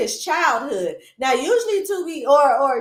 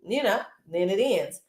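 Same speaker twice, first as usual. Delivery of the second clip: ordinary talk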